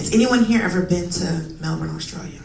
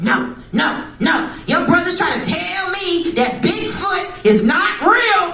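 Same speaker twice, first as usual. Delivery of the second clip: higher, slow indignant explaining; mom's indignant voice; 8 semitones higher, slower than usual. Retold or imitated. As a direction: mom explaining with outrage. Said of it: "She said".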